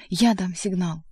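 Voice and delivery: ordinary delivery, nothing marked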